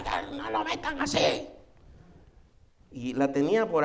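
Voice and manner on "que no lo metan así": fussing woman. Retold or imitated. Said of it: "una mujer haciendo un escándalo".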